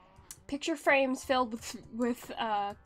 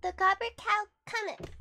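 high cutesy uwu voice; squeaky cute anime voice; 9 semitones higher than usual. Shown as a demonstration. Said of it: "That's barely the uwu voice".

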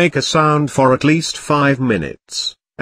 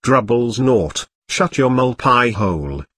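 usual speaking voice

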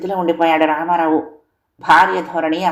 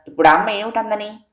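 mild rebuke; 4 semitones higher than usual. A narrator's reading. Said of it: "మందలింపుగా అన్నాడు రామారావు".

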